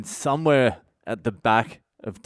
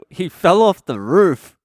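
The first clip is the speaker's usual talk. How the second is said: shocked disbelief; 8 semitones higher, 8 dB louder than usual. Retold or imitated. Shocked realization, retold.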